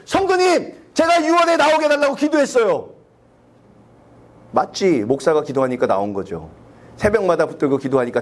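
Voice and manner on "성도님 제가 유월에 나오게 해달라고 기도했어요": very high, proud show-off to the member; mock boast. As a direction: brag about your prayer. (성도에게 높은 목소리로 으스대며)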